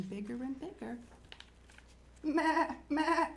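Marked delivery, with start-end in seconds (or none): 2.2-3.3 high bleating goat; short, raised-pitch goat cries. A narrator's reading. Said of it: "said the goat".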